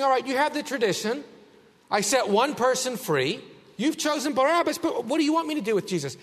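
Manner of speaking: a light, half-acted governor bargaining; paraphrasing Pilate. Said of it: "It's as if he's saying".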